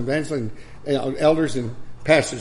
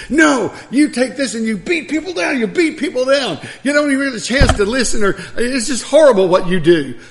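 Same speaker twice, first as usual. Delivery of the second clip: much higher, louder mock rant with laughter breaking in; angry old man; 10 semitones higher, 8 dB louder than usual. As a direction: mimic the old man scolding, laughing through it. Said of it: "He says".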